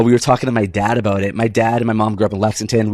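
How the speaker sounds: ordinary talk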